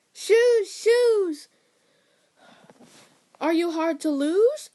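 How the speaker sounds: loud, high call at the runaway shoes. Retold or imitated. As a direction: yell it out to stop them. Lady calls out to the shoes.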